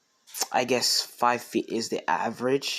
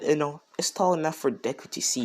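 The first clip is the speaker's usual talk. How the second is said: plain reading voice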